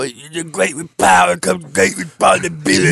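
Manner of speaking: shouted wise-uncle line. Retold or imitated. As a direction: deliver it like a solemn mentor. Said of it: "where as though".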